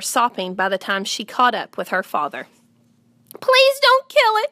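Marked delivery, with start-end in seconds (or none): 3.4-4.5 high, acted tearful begging; sobbing plea. A narrator's reading. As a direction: plead like a distraught little girl. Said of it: "she sobbed".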